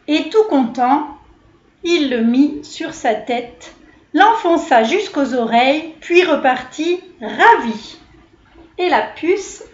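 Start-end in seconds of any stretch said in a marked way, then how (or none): none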